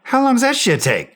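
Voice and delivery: an irritated cry of impatience at the radio; exasperated demand. Retold or imitated. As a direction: demand an answer, fed up. Exasperated while under fire.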